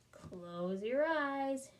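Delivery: very slow, calming instruction; slow soothing therapist. Acted. Therapist calming Camilla, very slow. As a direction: draw it out like a hypnotist. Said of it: "she said".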